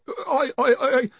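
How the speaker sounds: high, stuck, panicky stutter; tongue-tied with fear; terrified stammer. A narrator's reading. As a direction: stammer helplessly on one word. Terrified Collarbone cannot go on.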